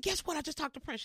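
high, bursting announcement; can't hold in the news; excited news. Retold or imitated. Calling her mother about [name], high and excited.

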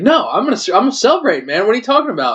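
high-pitched, indignant mock protest; Phillip Rivers. Quarterback insisting to the ref he'll celebrate, indignant mock protest. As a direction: protest like a player defending his celebration. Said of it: "And he's like".

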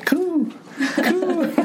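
high cooing like a pigeon. Retold or imitated. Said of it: "Like a pigeon".

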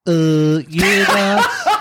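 playful put-on Brummy accent, answering as Paul. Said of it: "That was a fucking Brummy accent".

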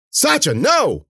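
high, loud warning; alarmed cry. A narrator's reading. Cece calling her dog off, crying out. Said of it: "Cece cried".

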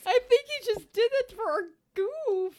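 high voice squeezed by laughter; real laughing fit. Real, not acted. Co-host explaining the band's fake accent, in stitches. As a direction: barely get it out while cracking up. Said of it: "good laughs like this".

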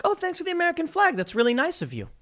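high mock thank-you from a friendly liberal; cheery liberal coworker. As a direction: play the pleased coworker taking the flag, sing-song high. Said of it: "will be like".

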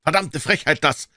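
high-pitched indignant bystander. A narrator's reading. Bystander outraged that the suspect came back, indignant exclamation. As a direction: exclaim at the nerve of it.

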